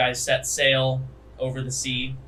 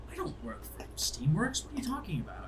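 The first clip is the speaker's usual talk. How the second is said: hushed, higher-pitched offended protest; 7 semitones higher, 8 dB quieter, faster than usual. Acted. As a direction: protest softly, taken aback. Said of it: "looks, looks offended".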